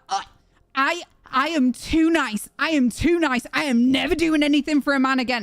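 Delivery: put-on girl ranting dramatically about being too nice; mock ranting girl. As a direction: play a dramatic girl going off. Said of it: "she's going off like this".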